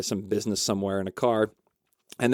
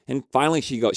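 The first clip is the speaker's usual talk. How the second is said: normal talking voice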